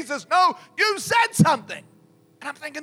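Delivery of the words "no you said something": high, loud insistent accusation; accusing shout. Retold or imitated. Possessed man insists to the preacher, accusing shout. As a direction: throw the accusation back loudly.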